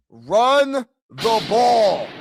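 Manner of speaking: high, loud, drawn-out words; emphatic fan shout. Real, not acted. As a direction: shout it slowly like a vindicated fan. Fan vindicated by the play.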